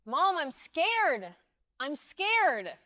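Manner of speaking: high, slow wail; scared little child's plea; frightened crying child. A narrator's reading. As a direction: wail to mom in fear. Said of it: "Daisy was crying uncontrollably now".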